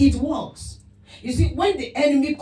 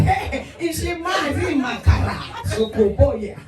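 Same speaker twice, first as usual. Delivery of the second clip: mock tongues, laughing; 4 semitones higher, slower than usual.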